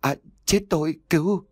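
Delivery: high-pitched strangled plea; choked cry for help. A narrator's reading. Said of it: "miệng ú ớ". (kêu cứu ú ớ, giọng cao vút)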